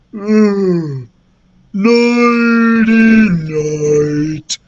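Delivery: drawn-out dazed ogre voice; groaning ogre. A narrator's reading. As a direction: groan it out woozily as if passing out. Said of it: "the ogre groaned as he passed out".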